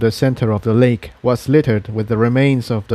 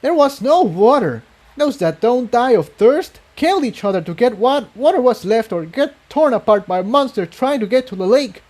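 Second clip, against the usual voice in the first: higher pony voice, grim and resentful; bitter purple pony; 12 semitones higher than usual. A narrator's reading. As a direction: tell it like a grievance, in a higher voice.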